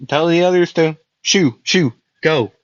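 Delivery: usual speaking voice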